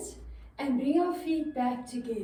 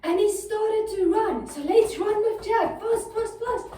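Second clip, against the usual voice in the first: excited; 6 semitones higher, 4 dB louder than usual. Acted. Running from the giant.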